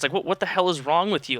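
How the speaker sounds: ordinary talk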